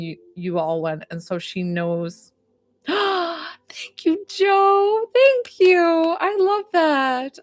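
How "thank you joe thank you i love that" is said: high, drawn-out, touched thanks after a gasp; choked up with thanks, pitched way up; real teary-eyed gratitude. Real, not acted. Pet psychic moved by thanks from a client, teary high voice. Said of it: "I got all teary-eyed".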